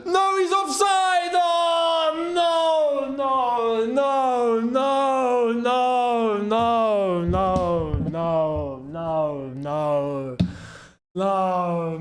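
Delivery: deflate from outrage to defeat; crushed despair. Real, not acted. Goal ruled offside.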